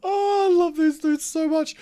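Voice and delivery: high, slow delighted gush; dawning joy. Real, not acted. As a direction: melt with fond delight. Delight at game characters.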